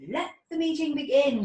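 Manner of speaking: loud, high official call to order; serious announcement. A narrator's reading. Fairy official opening the fairy meeting, loud and serious. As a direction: open the meeting like a stern official. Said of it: "she announced in a serious voice".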